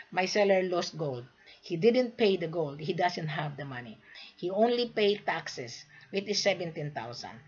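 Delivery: ordinary talk, nothing marked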